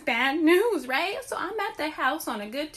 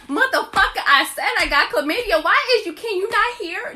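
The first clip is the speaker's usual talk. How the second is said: loud, high exasperated shout; hammed-up furious shout in the lobby; mock yell; 7 semitones higher, 7 dB louder than usual. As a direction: yell it at someone who won't listen. Yelling at boyfriend in lobby.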